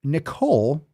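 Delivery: ordinary talk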